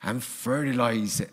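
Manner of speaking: slow, rural West Country gardener; West Country accent. Acted. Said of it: "Why do I say that in a West Country accent?".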